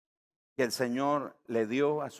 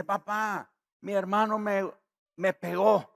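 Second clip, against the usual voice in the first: put-on high, tearful child's complaint; a young daughter tattling to her papa through tears; crying little girl; 9 semitones higher, 6 dB louder than usual. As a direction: whine it like a hurt little daughter. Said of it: "su hija entró a la habitación llorando".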